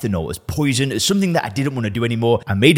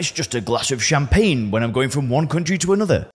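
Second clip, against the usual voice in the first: higher, whiny voice of a drinker making excuses; mock defensive drinker; 5 semitones higher than usual. Host voicing a listener who defends free plane drinks, mock defensive and higher. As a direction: play the listener justifying their plane drinks. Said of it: "What's your initial reaction to that?".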